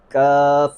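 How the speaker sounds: "کاف" drawn out with an audible puff of breath at the end; "کاف" held long, breath after the f; Kaf with hams. Shown as a demonstration. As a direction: model "کاف" slowly, releasing the final sound with air. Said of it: "تو اسے ہم کیسے ادا کریں گے".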